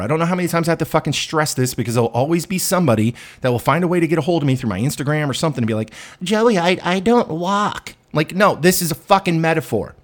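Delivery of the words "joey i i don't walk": high, slow whiny fan voice; drawn-out complaining follower; mock whiny listener. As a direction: whine it like a nitpicking fan.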